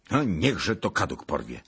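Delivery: ordinary delivery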